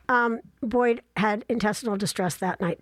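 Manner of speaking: normal talking voice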